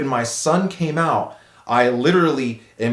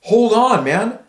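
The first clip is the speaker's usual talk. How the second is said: high, rushed panic asking for a moment; panicked plea; 8 semitones higher than usual. Retold or imitated. New father holding his baby, pleading for time in panic. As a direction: beg for time, voice up and scared. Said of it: "I'm like".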